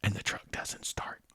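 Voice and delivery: whispered, hushed punchline; dramatic whisper. Real, not acted. Telling the ghost story, whispering the scary beat. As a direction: drop to a whisper for suspense. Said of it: "hushed dramatic narration".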